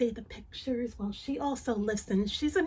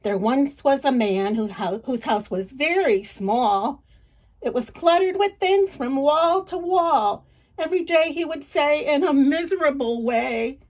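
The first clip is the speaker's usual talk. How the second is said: louder, lively read-aloud storytelling; story narration; 3 semitones higher, 8 dB louder than usual. Acted. Reading a folk tale to children, lively narration. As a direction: tell it with energy to a child.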